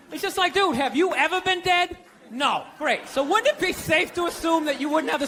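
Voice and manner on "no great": ordinary delivery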